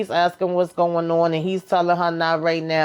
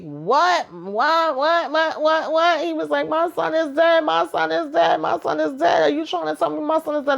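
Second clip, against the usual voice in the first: frantic, much higher, repeating in shock; a father hearing his son is dead; panicked disbelief; 9 semitones higher than usual. Retold or imitated. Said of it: "he start going all crazy and crying".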